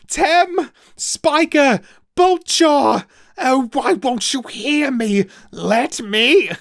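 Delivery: high, loud calls for help; trapped boy shouting for his friends over the storm; desperate calling. A narrator's reading. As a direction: yell the names to be heard over the storm. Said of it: "he cried".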